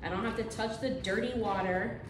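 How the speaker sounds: ordinary talk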